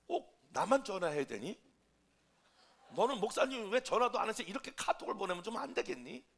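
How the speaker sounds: loud, acted-out counter-complaint; turning the tables. Pastor turns the blame on the crying member, loud reproach. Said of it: "내가 다 반전시켰어".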